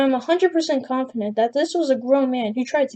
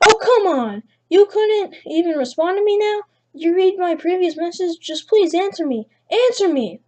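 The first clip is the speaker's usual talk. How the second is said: angry demand; 6 semitones higher than usual. Acted. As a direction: snap at her to answer. Stranger demanding a reply angrily.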